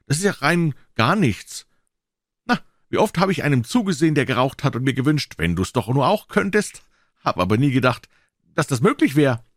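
put-on high-pitched boy's voice, showing off; high, cocky kid; boastful boy Tom, breezy. A narrator's reading. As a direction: play a boy bragging, pitched well up.

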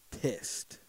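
breathy whisper of pissed. Acted. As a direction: drop to a hissed whisper on pissed. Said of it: "my wife can see that I'm pissed".

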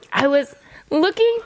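retold lady driver. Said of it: "And then she said".

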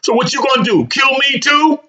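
a mother challenging her furious son; rhetorical challenge. A narrator's reading. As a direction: dare him with the question. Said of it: "offering rhetorical reasoning".

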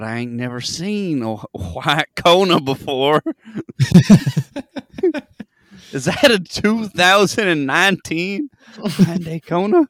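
high, slow, hammed-up yokel voice. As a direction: gawk at the car in a silly drawl.

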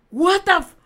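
loud, high retold "what the fuck"; jolted awake in shock; startled disbelief. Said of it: "Así me quedé". (sobresalto incrédulo, fuerte y agudo)

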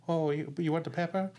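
high, hesitant little timid guy; timid Sandler voice. Retold or imitated. Retelling the sketch to a friend, a timid Sandler voice. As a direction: shrink back and offer it timidly. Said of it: "he's doing his Sandler, really timid kinda guy".

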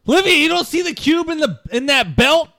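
high, loud incredulous call; pleading holler; exasperated shout. Acted. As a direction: yell it across in disbelief. Shouted exchange across the room.

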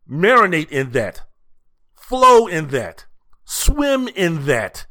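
slow, drawn-out savoring. Acted. Host telling listeners to soak in Saban's greatness, slow savoring. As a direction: stretch each phrase out, let it soak in.

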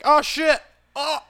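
high-pitched alarmed yelp; mock panic, voice jumping up; alarmed Krillin. Mimicking Krillin facing a demon king, alarmed. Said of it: "Krillin was like".

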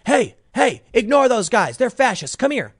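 high, eager wave-you-over voice; mock leftist recruiter. Leftists at the bar call you over, high eager mock voice. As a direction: lightly play a pushy activist calling you over. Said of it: "they say".

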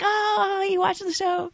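high, teasing quote of the celebrity; mocking celebrity tease. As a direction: lift it up playfully, like teasing a fan. Johnny Fairplay quoted teasing.

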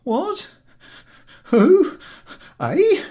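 very high startled cries; alarmed waking. Acted. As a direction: jolt awake in alarm. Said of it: "gazes in alarm".

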